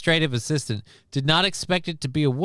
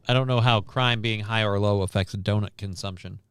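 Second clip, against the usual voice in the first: ordinary talk, nothing marked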